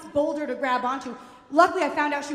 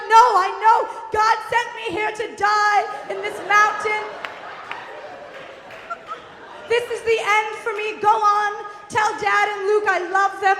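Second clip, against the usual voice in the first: high, loud doomed farewell; hammed-up martyr mom wail; melodramatic mother; 7 semitones higher, 4 dB louder than usual. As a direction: proclaim your last words, pitched up.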